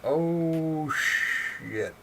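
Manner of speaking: long, high, loud drawn-out "oh", then "shit"; stunned exclamation. Retold or imitated. Reader reacting to a comic-book beheading, drawn-out amazed shock.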